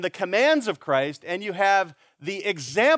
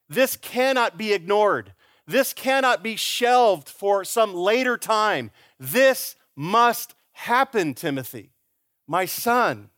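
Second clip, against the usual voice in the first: forceful preaching; 4 semitones higher, slower than usual. Acted. Preaching climax.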